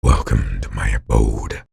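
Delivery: very low, slow growled greeting; demon voice. Shown as a demonstration. Showing viewers speech in the demon voice, low and slow. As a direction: growl a sinister welcome. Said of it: "speak in it".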